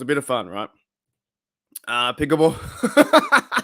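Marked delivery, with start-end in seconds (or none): 1.9-3.6 drawn out slowly through a laugh; cracking up while reading the name; amused slow chuckle on the name. Real, not acted. Reads chat name, laughing.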